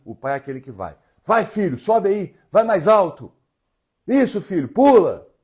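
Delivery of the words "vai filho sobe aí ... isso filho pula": loud, high cheering of a dad; cheering father. Retold or imitated. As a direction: egg the kid on, shouting up to the slide. Father cheering child on playground.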